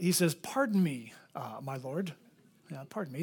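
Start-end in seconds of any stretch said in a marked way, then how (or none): 0.3-2.1 softly, meekly polite; a humble hushed pardon me. Retold or imitated. Gideon meekly answering the angel, hushed and polite. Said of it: "he's very polite". 2.9-3.2 meek and polite. Retold or imitated. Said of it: "he's very polite".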